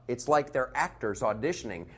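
ordinary talk

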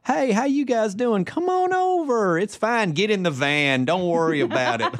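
high, slow sweet Southern drawl; lulling Southern accent. Retold or imitated. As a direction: lure them in with Southern charm. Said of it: "The Southern accent is just supposed to lull you".

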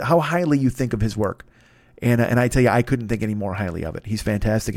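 normal talking voice

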